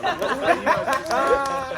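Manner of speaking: high, loud, drawn out through laughter; giggling disbelief; laughing question. Retold or imitated. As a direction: stretch it out while cracking up. Laughing imitation of reply.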